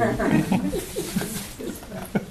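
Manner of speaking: soft, high giggle; quiet high-pitched chuckling; real laugh. Real, not acted. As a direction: let a high laugh tail away quietly. Amused at own greeting.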